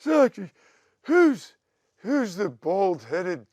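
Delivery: high, loud mock [name] voice, the bald-headed dragged out slowly; imitating [name]. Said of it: "he goes".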